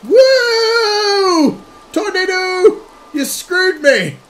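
loud, high-pitched wail of protest; high alarmed shout. Real, not acted. Player thrown by a tornado, alarmed shouting. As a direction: yell high at being flung about.

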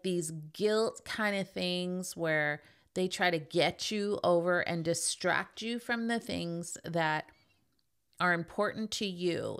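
ordinary talk, nothing marked

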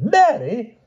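very high, rising outraged Mary?; disgusted exclamation. A narrator's reading. Pellinore scorning Grumore's guess of Mary, outraged squeal. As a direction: squeal the name Mary? in disbelief. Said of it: "he exclaimed".

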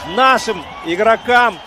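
high, loud, slow joyful shouting; goal celebration. Real, not acted. (ликующий крик после гола)

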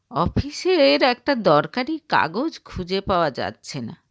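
ordinary delivery

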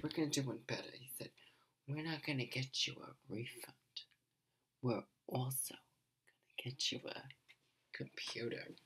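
hushed whisper so Jim can sleep. Real, not acted. Woman whispering so her sleeping husband Jim won't wake. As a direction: keep it to a whisper, someone's asleep. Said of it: "sorry, I'm talking so quietly".

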